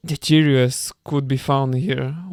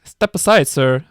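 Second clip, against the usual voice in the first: put-on heavy American accent; 5 dB louder, slower than usual. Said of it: "with a heavy American accent".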